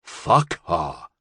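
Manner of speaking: low, irritated gangster dismissal; irritable. A narrator's reading. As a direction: brush her off irritably. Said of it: "Al said irritably".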